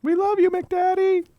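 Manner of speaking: high, slow, gushing fan call; slow high adoring cheer. Acted. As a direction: call out slowly, starry-eyed. Crowd member cheering mayor.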